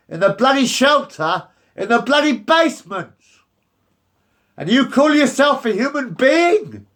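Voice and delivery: building into an angry shout; angry rant. Real, not acted. Man ranting at the council about losing home, furious. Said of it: "I'm very angry".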